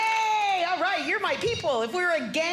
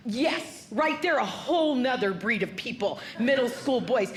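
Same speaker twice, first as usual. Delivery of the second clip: ordinary delivery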